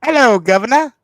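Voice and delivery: loud, high mock-British greeting; big, showy British accent. Shown as a demonstration. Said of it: "All right, British accent".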